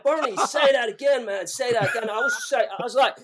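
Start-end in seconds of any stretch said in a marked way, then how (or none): 0.0-2.1 higher, louder, eager imitation of an amused singer; retold helpless delight. Retelling [name] begging to hear it again, excited imitation. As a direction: urge them excitedly, as if tickled. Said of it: "he was crying with laughter".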